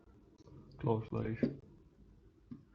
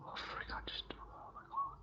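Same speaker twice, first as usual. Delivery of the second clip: whispering; 19 dB quieter than usual. Real, not acted. Sudden mishap muttered aside.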